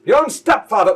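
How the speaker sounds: loud, high, indignant father; mock-outraged holler at your son; affronted stepdad. Stepdad affronted by offer of payment.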